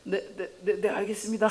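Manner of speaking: high, repeated eager yeses; flustered compliance. Acted. Student accepts the teacher's order, flustered compliance.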